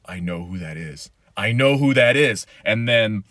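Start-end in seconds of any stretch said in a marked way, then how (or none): 0.1-1.1 a low, hushed "I know who that is"; hushed recognition. Retold or imitated. Retelling the Mandalorian finale to viewers, hushed and awed. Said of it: "And it's like". 1.4-2.4 higher, louder "I know who that is"; excited exclaim. Retold or imitated. Retelling the Mandalorian finale to viewers, loud and thrilled. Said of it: "And it's like".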